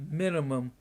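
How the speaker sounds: ordinary talk, nothing marked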